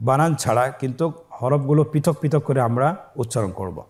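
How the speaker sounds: ordinary delivery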